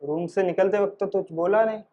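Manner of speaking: usual speaking voice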